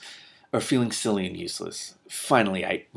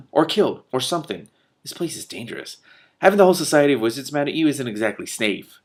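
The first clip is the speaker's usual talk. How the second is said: snapping; 6 semitones higher, 6 dB louder than usual. Acted. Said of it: "she snapped".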